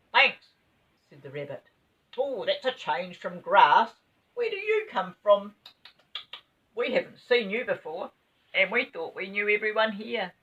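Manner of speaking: a rabbit nibbling cake, chatting; chirpy rabbit. Acted. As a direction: play a friendly curious rabbit. Rabbit character voice.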